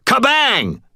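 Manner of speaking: high, loud panicked spell word; panicked shout. A narrator's reading. Wizard shouting a spell in panic at an unseen presence. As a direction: blurt it out in sudden panic. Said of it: "whirled in panic and shouted".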